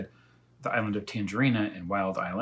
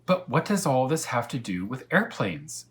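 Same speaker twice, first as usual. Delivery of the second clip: put-on eager, raised boyish question; curious boy; 5 semitones higher, 6 dB louder than usual. Elmer impatiently asking the cat, raised voice. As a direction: ask it like an impatient kid.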